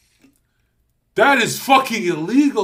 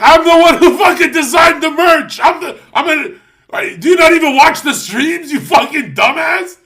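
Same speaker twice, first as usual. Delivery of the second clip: yelling much higher and louder; furious shout; 10 semitones higher, 10 dB louder than usual. Retold or imitated. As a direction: scream him down over the merch. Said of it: "I'm like".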